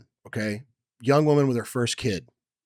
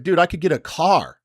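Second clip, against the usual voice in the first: higher and louder, retold amazement; excited exclamation; 7 semitones higher, 6 dB louder than usual. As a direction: blurt it out like a big discovery. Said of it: "I was like".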